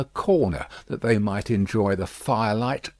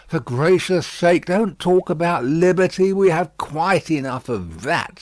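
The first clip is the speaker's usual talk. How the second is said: raised, loud huffy protest; indignant; 6 semitones higher, 6 dB louder than usual. A narrator's reading. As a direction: scold it, scandalised. Miss Pross indignant.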